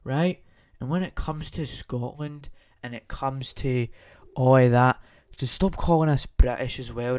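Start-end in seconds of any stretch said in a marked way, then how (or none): 4.4-7.2 loud fed-up shout; furious shout at people who call him British; angry rant. Real, not acted. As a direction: blast it out, indignant. Angry rant about being called British.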